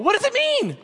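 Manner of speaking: high-pitched incredulous plea; emphatic questioning. Real, not acted. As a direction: pitch leaps way up in mock exasperation. Preacher presses his rhetorical question.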